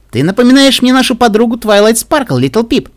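high, loud chirpy chatter; bubbly. A narrator's reading. Pinkie chats to Little Pip, bubbly. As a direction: chirp it to a new friend.

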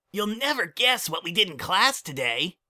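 put-on high, bouncy kid voice; young colt voice. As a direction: play an eager little colt. Child character voice.

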